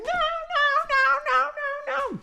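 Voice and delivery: very high, squeaky string of noes; piping little-kid refusal; protesting kids. Acted. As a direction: squeal it like a horrified child. Kids reject boiled grass.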